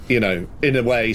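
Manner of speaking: ordinary delivery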